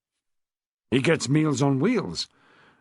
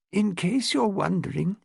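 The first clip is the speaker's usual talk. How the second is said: put-on higher, refined elderly upper-class voice; posh old lady; 6 semitones higher than usual. Old lady tells Rose about her husband, posh elderly voice. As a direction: speak like a frail, well-bred widow of seventy.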